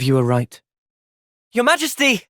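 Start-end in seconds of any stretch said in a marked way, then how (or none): 1.5-2.2 startled, pitched-up cry of Your Majesty; surprised call. Acted. Companion startled at seeing the queen, calls out.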